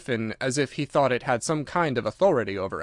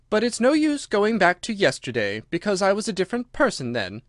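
lightly voiced girl, higher pitch; Alice, explaining; 7 semitones higher than usual. Alice explains herself to the creatures, higher and earnest.

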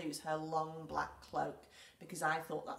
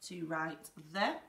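ordinary talk, nothing marked

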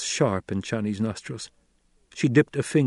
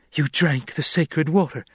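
higher, sudden blurt; 6 semitones higher than usual. A narrator's reading. Jessica realising Paul drank the water, blurting higher. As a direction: let it burst out before she can stop it. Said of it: "she blurted".

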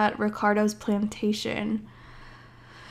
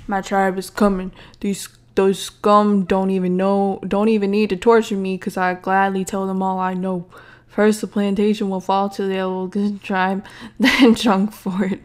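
slightly lowered bad guy with put-on grit; attempted deep voice. Prisoner promises the tribe is coming, put-on deep voice. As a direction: play a tough, confident prisoner. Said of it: "That's the deepest voice I can do".

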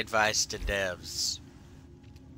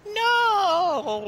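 high mock GLaDOS no. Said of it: "Her best quotes would be".

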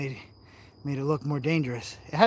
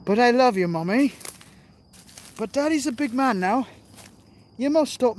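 put-on higher, louder, like a little boy to his mum; hammed-up baby voice begging Mommy; a grown man playing a whiny kid to his mother; mock childish plea; 6 semitones higher, 4 dB louder than usual. Pleading to mom jokingly.

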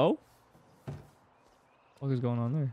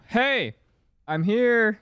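loud, higher call across the house; 10 semitones higher, 6 dB louder, much slower than usual. Pretend. Player calling to a friend in the house, loud. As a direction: call out so she hears you.

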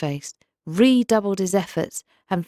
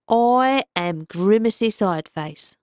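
a lightly voiced kindly fish; wise old pike; 4 semitones higher, 4 dB louder than usual. A narrator's reading. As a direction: introduce yourself as a proud river guardian. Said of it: "said the fish".

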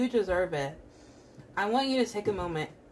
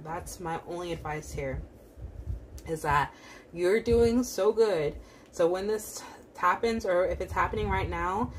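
ordinary talk, nothing marked

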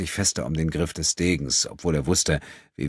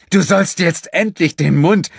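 angry scolding; 13 semitones higher, 10 dB louder than usual. Acted. Enrico scolds Gina, in pain.

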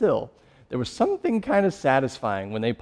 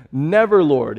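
higher and louder, a sharp protest; forceful rebuke; 3 semitones higher, 8 dB louder than usual. Acted. Peter rebuking Jesus, loud protest. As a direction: throw the word Never out as a rebuke. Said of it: "began to rebuke him".

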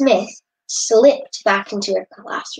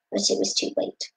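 plain reading voice

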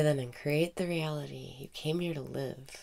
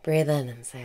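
guided meditation. Acted. Meditation teacher guiding listeners through breathing, calm meditation voice.

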